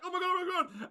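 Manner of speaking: mock-frightened little squeal; spooked panic. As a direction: squeak it in comic alarm. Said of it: "have been um, thoroughly spooked".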